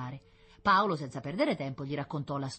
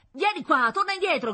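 loud, high-pitched yell; shouted order; 10 dB louder than usual. A narrator's reading. Firemen calling back a runaway boy, shouting. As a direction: shout a warning across the distance. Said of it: "gridavano i pompieri".

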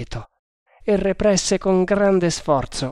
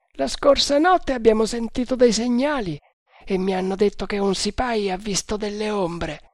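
a higher voiced guard, confident; overheard guard; 8 semitones higher than usual. A narrator's reading. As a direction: play the first guard, sure of himself. Guard tells rumours. (guardia sicura di sé, voce più alta)

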